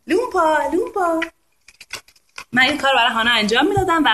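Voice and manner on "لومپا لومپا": high-pitched playful elephant call; sing-out nonsense elephant noise to amuse a baby; elephant sound. Shown as a demonstration. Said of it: "فیل میگه".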